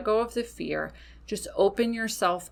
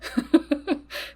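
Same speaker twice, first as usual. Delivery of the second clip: breathy laugh; 8 semitones higher than usual. Real, not acted. Laughing at her own bit.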